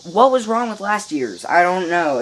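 ordinary talk, nothing marked

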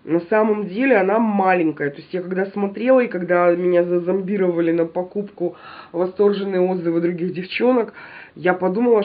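ordinary delivery

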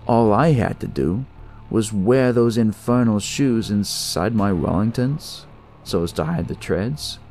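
ordinary delivery, nothing marked

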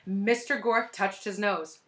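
ordinary delivery

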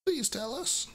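high Mudkip plea; high pleading Mudkip. Acted.

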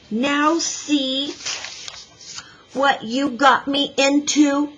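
high, irritated scolding; cross. A narrator's reading. Boy scolds little girl stuck in mud, cross. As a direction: scold with weary annoyance, pausing midway. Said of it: "he said crossly".

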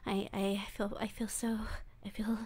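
creepy, giddy yandere voice, swinging between coos and hushed whispers; obsessive captor. Acted. Captor coos at tied-up Wolfie, creepy and giddy. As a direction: coo over your helpless captive like a lovesick stalker.

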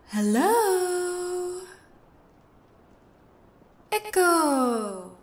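loud call to hear the echo. Real, not acted. Intruder calling out to hear the kitchen echo. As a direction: shout into the room to test the echo.

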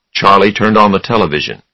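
plain reading voice

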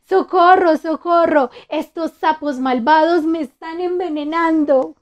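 panicked cry for help. A narrator's reading. Monster cries for help.

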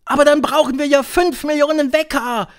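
high, loud protest; high-pitched outcry; crowd crying out. A narrator's reading. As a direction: shout the objection like an alarmed audience. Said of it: "schrien die Zuhörerinnen".

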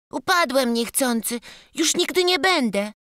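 put-on high, timid childlike apology; a scared little boy stammering out his excuse. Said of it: "wyjąkał malec".

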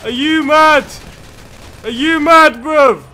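high, loud outraged yelling; angry yell. Real, not acted. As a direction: yell in disbelief. Yelling at other drivers.

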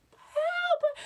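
very high, long scream for help; piercingly high cry of terror; acted scream. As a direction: shriek for rescue. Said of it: "I'm screaming all the way".

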